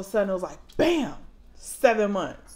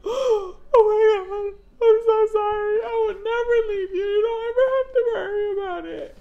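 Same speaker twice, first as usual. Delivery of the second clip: high, loud, tearful pleading; tearful apology; 14 semitones higher, 6 dB louder than usual. Retold or imitated. Mom to her crying kindergartner at the bus, loud tearful apology. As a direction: near tears, begging her child's forgiveness.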